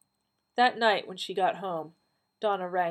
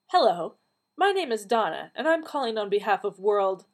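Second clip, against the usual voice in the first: louder, upbeat phone-script delivery; telemarketer greeting; 4 semitones higher, 7 dB louder than usual. A narrator's reading. Donna cold-calling for a charity, telemarketer greeting. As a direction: recite a cold-call opener brightly.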